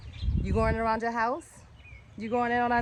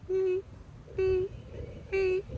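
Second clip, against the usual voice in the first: high playful beep beep beep; mock beeping; 9 semitones higher, 5 dB quieter, slower than usual. Walking the viewers past, high playful beeping. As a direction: chirp it like a little horn.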